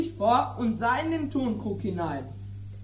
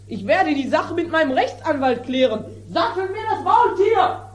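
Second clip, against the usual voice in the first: a higher, agitated stop-start voice of a furious farmer; irate landowner; 6 semitones higher than usual. Acted. Landowner fuming at the mended jar, agitated and higher. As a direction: sputter with outrage over the broken jar. Don Lollo rages at ruined jar.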